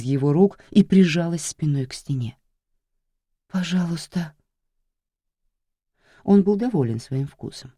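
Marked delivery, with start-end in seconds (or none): 3.5-4.3 мольба. Acted. Жертва умоляет.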